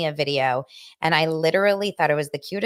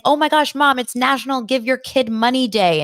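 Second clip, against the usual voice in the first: put-on higher, louder, gushing kid voice; voicing an eager son, excited and louder; 6 semitones higher, 6 dB louder than usual. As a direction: play up the kid's excitement. Son's excited text voiced.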